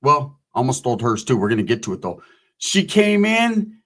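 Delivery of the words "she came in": drawn out. Acted.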